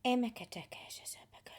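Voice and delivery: breathy unvoiced syllables, very soft; whispered light language. Channeler whispering light language to listeners, hushed.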